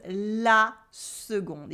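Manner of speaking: la seconde, drawn out slowly; slow model. Shown as a demonstration. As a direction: stretch la seconde for learners. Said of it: "slow modelled word".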